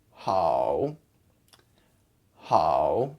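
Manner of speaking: "hao" sinking low, then rising; slow low dip and rise on "hao"; dipping tone. Shown as a demonstration. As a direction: drop "hao" down and bring it back up. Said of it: "has a dipping tone".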